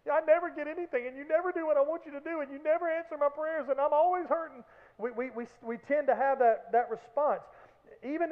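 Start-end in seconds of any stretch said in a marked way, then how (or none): 0.1-4.6 fast, high whining list of complaints; whiny complaining. Retold or imitated. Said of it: "we turn into hyperbole machines".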